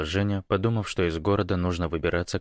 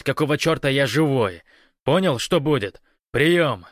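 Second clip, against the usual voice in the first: raised, insistent commanding voice; urgent radio orders; 6 semitones higher, 5 dB louder than usual. A narrator's reading. Гош orders comrade to rush to the ranch, urgent and forceful. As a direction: drive the orders home over the radio.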